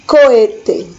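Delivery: "cohete" com H mudo, "co-e-te"; pronúncia espanhola. Shown as a demonstration. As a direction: diga "cohete" sem som de H, "coete". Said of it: "mas o H não tem som". ("cohete" dito sem o H, como "coete")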